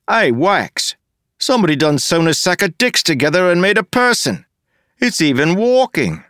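high, loud, mocking rogue voice; a rough joker jeering at the mayor; cheeky Wayne. Wayne insulting the mayor to Wax, high mocking jeer.

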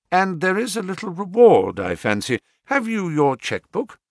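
ordinary delivery

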